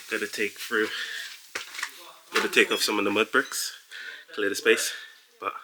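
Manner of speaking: panting between words; breathless, gasping for air; out of breath. Real, not acted. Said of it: "I'm a bit out of breath".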